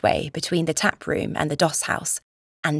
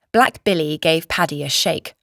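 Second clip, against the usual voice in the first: plain reading voice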